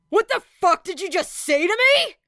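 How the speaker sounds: furious yell at an insult; furious shout. Acted. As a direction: explode with rage. Bakugo explodes at Era.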